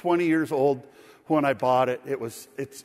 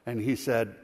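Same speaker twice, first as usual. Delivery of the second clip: ordinary talk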